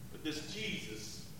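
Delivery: ordinary talk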